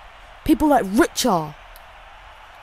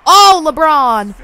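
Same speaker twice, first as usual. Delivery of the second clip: loud, higher, drawn-out exclamation; loud gamer whoop; excited shout; 5 semitones higher, 12 dB louder, slower than usual. Real, not acted. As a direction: holler it out at the big play. Exclaiming at a game play.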